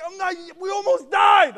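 high, loud panicked yell; mock-hysterical retort; overreacting. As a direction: shout it in hammed-up alarm. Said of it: "I'm just trying to overreact".

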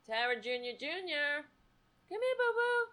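high, soft coaxing call; cat-calling voice. Real, not acted. Owner coaxing her cat, high and soft. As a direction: sweet-talk the cat over.

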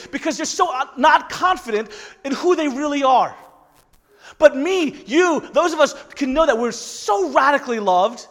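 high, loud rising sermon voice; preaching at full force. Acted. Pastor urging the church to honor everyone, fired-up preaching. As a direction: drive it home to the congregation with fire.

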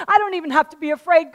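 ordinary talk

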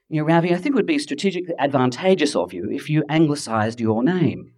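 ordinary talk

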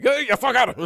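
high, loud shout with a laugh; high laughing mock shout; mock triumphant yell. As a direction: crow it at the last man standing.

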